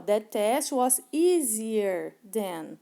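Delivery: very slow, deliberate English for learners; slow model sentence. Shown as a demonstration. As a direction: lay each word out like a teacher modeling. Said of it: "Então, ó". (frase em inglês bem lenta e didática)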